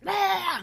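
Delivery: short high-pitched mock scream; imitated scream. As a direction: imitate a sudden waking scream. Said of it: "She was screaming".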